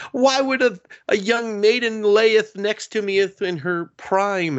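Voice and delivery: put-on high, pompous old-English; mock archaic fluster. Boy flustered by girl in his bed, high archaic ham. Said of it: "he's just like".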